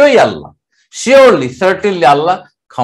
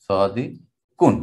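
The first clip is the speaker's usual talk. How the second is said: slow drawn-out word; 9 semitones lower, 7 dB quieter, much slower than usual. Shown as a demonstration.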